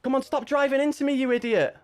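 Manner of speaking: high-pitched furious yell at another driver; angry shout. Real, not acted. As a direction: yell it out in real frustration. Driver rammed from behind.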